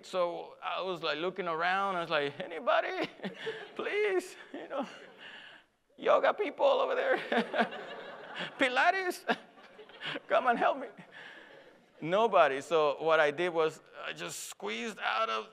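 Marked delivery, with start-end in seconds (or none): 2.4-10.9 high, comic plea through laughter; mock plea for help. Stuck lifter begging anybody around for help, mock pleading. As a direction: call weakly for help, half laughing.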